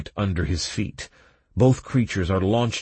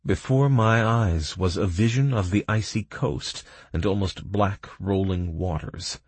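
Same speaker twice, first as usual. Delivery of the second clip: plain reading voice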